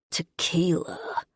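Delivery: slow, croaked reaction to a drink. A narrator's reading. Man reacting to the drink he just downed, croaking. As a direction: croak the word out slowly. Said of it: "he croaks".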